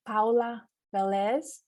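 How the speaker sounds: name said slowly in a Spanish accent. Shown as a demonstration. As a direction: pronounce it the Spanish way. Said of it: "pronounce with my Spanish accent".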